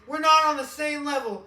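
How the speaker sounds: high, loud indignant rant; hammed-up indignant ranting; mock rant at cop. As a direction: tell off the sheriff. Ranting at the sheriff.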